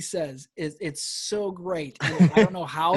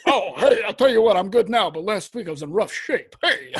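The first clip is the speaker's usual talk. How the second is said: Rodney Dangerfield; 2 semitones higher, 7 dB louder than usual. Acted. Comic hitting the stage as Rodney, loud act switched on. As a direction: burst on loud like a comic working the crowd. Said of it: "he, like, hits the stage".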